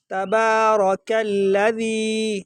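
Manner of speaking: تبارک الذی slowly chanted with a soft "dh"; soft dhal. Shown as a demonstration. Teacher modelling after the warning, soft dhal in الذی. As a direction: keep the dh of الذی gentle, never a hard z. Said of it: "ذال کو جو ہے نا اس کو نرم پڑھا جائے گا".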